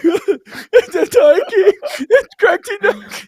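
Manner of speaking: very high, loud laughing sob; laugh-crying. Real, not acted. Telling the co-host about the movie, laughing while tearing up. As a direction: laugh and cry at once, voice cracking up high. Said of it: "I started sobbing".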